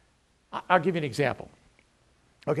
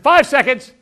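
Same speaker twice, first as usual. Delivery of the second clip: in-your-face bark; 9 semitones higher, 14 dB louder than usual. Retold or imitated. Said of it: "I'm in their face".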